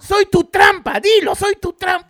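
high, loud imitated shouting; acted angry outburst. Woman confronts her partner mid-show, acted angry outburst. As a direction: yell it high, as the furious girlfriend.

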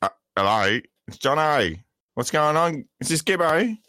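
laying on a mock Australian accent; put-on Australian accent. Pretending to ring in from Tassie in a mock Aussie accent. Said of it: "the way your accent disappears every now and then".

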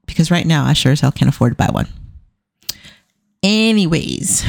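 normal talking voice

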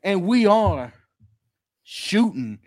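grand showman announcing the act; ringmaster. Acted. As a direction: hype it up like a big-top host. Circus-style show intro continues.

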